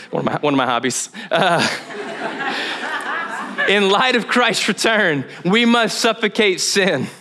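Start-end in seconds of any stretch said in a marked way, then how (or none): 1.7-3.6 a high giggle breaking out. Real, not acted. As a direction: laugh it off, embarrassed. Laughing at own confession.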